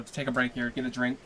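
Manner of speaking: usual speaking voice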